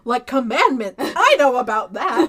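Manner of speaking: loud gasp of delighted recognition; mock eager excitement. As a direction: burst out proudly, close to laughing. Preacher's eager recognition, retold.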